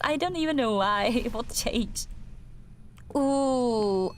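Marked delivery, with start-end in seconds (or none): none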